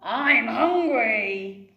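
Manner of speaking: loud, slow dragon rumble; dragon roar. Acted. Hungry dragon on the steeple, slow loud roar. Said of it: "it roared".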